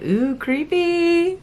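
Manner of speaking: high, slow, drawn-out ooh; mock creepy ooh. Reader imitating her own thrill, high slow ooh. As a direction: coo it like a ghost story thrill. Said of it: "It's like".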